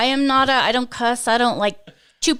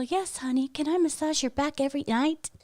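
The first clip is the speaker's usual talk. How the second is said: soft sweet wife voice; a hint of a docile wife; mock submissive wife; 5 semitones higher, 9 dB quieter than usual. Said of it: "soft, gentle".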